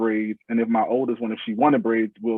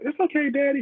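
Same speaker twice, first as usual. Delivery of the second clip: much higher, put-on little girl voice; voiced young daughter. Daughter reassuring her daddy, high child voice. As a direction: imitate a small daughter in a high pitch. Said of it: "my daughter would be like".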